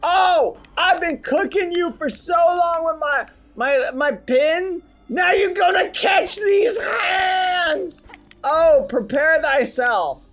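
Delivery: high, loud hammed-up threats; trash-talk the boss like a tiny warrior; mock fighting taunts. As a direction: melodramatic squeaky battle bravado.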